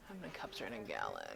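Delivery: low, soft thinking aloud; private, under-the-breath wondering; muttering to self. Real, not acted. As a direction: mutter the question to yourself, low and quiet. Mutters question to herself before asking aloud.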